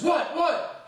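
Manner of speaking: high, loud urgent "What? What?"; eager high questions. Retold or imitated. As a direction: press him, dying to know. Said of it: "Brennan says".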